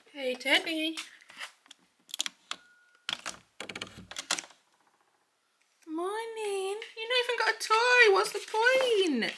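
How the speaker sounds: high, sing-song pet voice; talking to the dog. Real, not acted.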